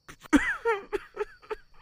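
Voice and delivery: high, broken laugh through tears; tearful laugh. Acted. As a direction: laugh to keep from crying. Grieving over his mother.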